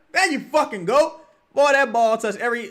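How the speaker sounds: loud, high, thrilled cheer; excited yelling. Real, not acted. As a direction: still riding the celebration. Still celebrating the shot.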